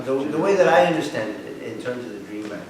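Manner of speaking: normal talking voice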